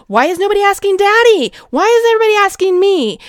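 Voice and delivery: put-on high, fast, loud whiny kid voice. Wife voicing her kids pulling at her legs, high whiny child voice. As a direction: whine like a nagging child. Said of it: "They're asking me".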